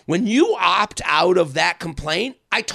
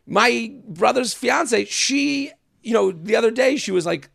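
ordinary talk

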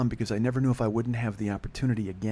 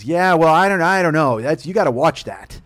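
mock warning mother; 5 semitones higher, 9 dB louder than usual. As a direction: imitate a flustered mother cautioning her son. Mother warning son, acted.

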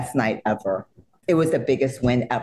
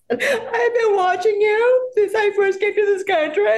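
high, louder teary gush with laughter; tearful gush; 3 dB louder than usual. Retold or imitated. Fan telling her idol she watched her for years, laughing through tears.

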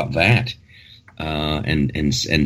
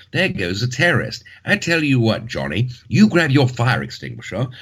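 mock posh British; 3 semitones higher than usual. Host imitates a Brit grabbing his narwhal tusk, hammed-up British. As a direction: play a jolly British hero rallying his mate. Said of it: "I say".

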